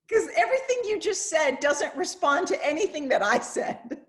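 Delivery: amused disbelief, laughing it out. Real, not acted. Laughing about not being heard.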